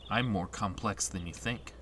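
low, quiet aloof reply; guarded game hero. Acted. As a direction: keep them out, voice sunk low. Voices Squall's guarded answer.

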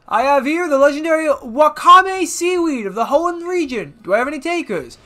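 hammed-up auction announcer; auctioneer. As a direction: announce it to the whole crowd. Voices the auctioneer.

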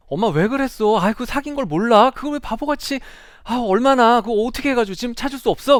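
high, loud scolding cry; exasperated daughter. Retold or imitated. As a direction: yell at mom in frustrated disbelief. Daughter scolds mother over scam.

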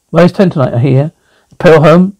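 usual speaking voice

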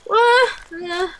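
yelp, then a smaller echo of it; startled cries easing off; frightened yelps. Real, not acted. Scared in the dark game.

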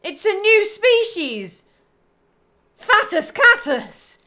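high, slow, drawn-out announcement; amazed proclamation. Real, not acted. Announcing a new species of fat cat, amazed proclamation. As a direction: proclaim a ridiculous discovery in amazement.

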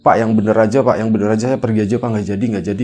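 loud, exasperated protest; annoyed. Retold or imitated. Yogas refusing the naked man, loud and annoyed. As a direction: push back hard, annoyed and loud. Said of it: "Mas Yogas pun kesel gitu".

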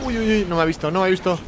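usual speaking voice

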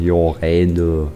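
normal talking voice

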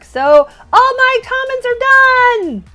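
high, loud, slow announcement; stretched celebratory cry; triumphant excitement. Real, not acted. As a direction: sing out the good news. Celebrating a completed part of collection.

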